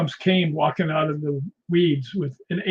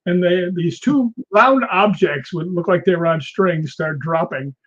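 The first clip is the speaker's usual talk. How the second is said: ordinary delivery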